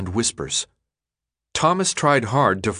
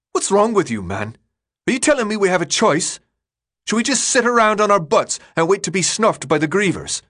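higher, louder and quick, heated; exasperated rant; 10 semitones higher, 3 dB louder than usual. Acted. Newt berates the defeatist leader in a heated, louder rant. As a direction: let the frustration boil over.

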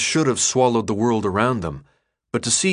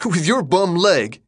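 mocking laugh, higher and slower; harsh laugh; 8 semitones higher, 4 dB louder, slower than usual. A narrator's reading. Leader mocks the limping boy with a harsh laugh. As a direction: scoff at the boy's bum leg through a laugh. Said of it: "a harsh laugh escaping his lips".

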